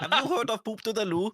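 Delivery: put-on high, loud Indian accent; hammed-up Indian accent, pitched high.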